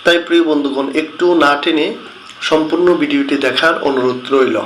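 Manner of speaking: ordinary talk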